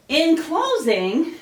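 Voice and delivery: breathless, slow rising phrase; winded after the chants; out of breath. Real, not acted. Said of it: "whew, I'm out of breath".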